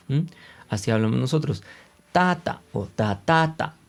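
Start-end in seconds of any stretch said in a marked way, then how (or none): 2.1-3.8 "Tata o tatata" with the next-to-last TA high and long; TA-ta, ta-TA-ta, high on the stressed syllable; Spanish penultimate stress. Shown as a demonstration. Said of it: "Nos gusta acabar en la penúltima".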